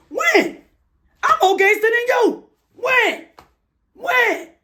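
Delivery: high-pitched sarcastic mimicry of a tough guy; mocking impression. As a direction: mimic him in a shrill taunting falsetto. Mocking the man's gangster boast.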